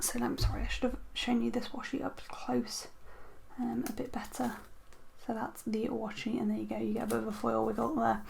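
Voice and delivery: hushed, breathy murmur; muttering to herself while adjusting the light; whispered aside. Real, not acted. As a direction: drop to a soft whisper. Apology while showing washi close.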